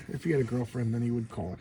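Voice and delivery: normal talking voice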